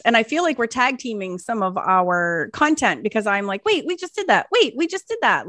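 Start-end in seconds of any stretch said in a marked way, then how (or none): 3.6-5.5 higher-pitched double take; mock surprise. Podcaster noticing a YouTuber covers the same content, mock surprise. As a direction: act out catching the overlap, pitched up.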